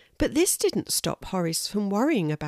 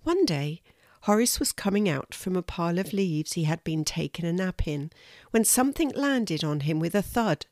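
ordinary delivery, nothing marked

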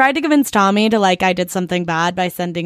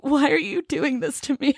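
mock pleading; 6 semitones higher, 3 dB quieter than usual. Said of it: "I was like".

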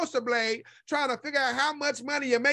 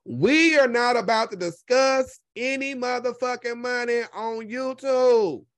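slow, word by word laying down the rule; slow emphatic; 4 dB louder, slower than usual. Real, not acted. Lays down a money rule to her viewers, slow and emphatic. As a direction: spell the rule out slowly for viewers. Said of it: "rules that Sean Bradley applies".

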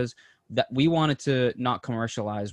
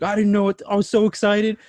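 excited; 9 semitones higher, 7 dB louder than usual. Retold or imitated. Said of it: "He was like".

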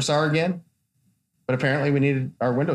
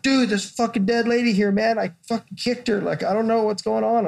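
mildly panicked, higher; 6 semitones higher than usual. Retold or imitated. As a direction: retell the freak-out with a touch of panic. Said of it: "I'm like".

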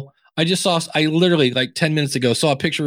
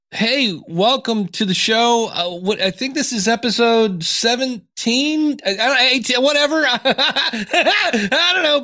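clueless host; 8 semitones higher, slower than usual. Retold or imitated. Mocking a clueless podcast host.

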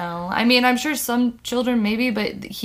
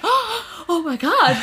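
awed; 7 semitones higher, much slower than usual. Retold or imitated. Awe at butterfly on finger.

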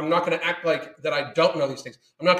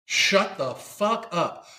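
slow emphatic dismissal; 2 semitones higher, much slower than usual. Real, not acted. Ranting at viewers who can't laugh at themselves, slow contempt. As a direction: drag out each word with contempt.

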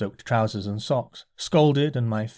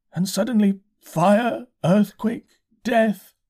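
high, slow, bewildered curate; dazed curate; 9 semitones higher, 3 dB louder, slower than usual. A narrator's reading. Curate pleading with the narrator, high and slow. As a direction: let it hang high and slow.

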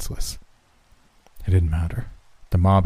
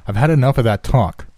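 raised, sharp and fed up; angry; 5 semitones higher, 6 dB louder than usual. Acted. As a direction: snap back, higher and louder. Will snaps at narrator.

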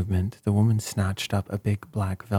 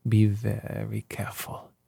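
plain reading voice

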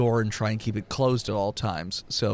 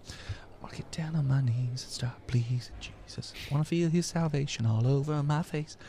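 whispering; 2 semitones higher, 6 dB quieter than usual. Acted. Joke prayer, unvoiced whisper.

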